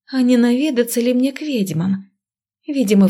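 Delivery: usual speaking voice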